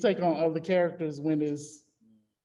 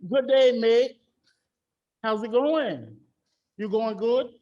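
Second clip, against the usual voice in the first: higher-pitched British accent, greeting phrase by phrase; put-on British accent; 5 semitones higher, 6 dB louder than usual. Shown as a demonstration. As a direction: play a chirpy British caller. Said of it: "throw 'em off with an accent".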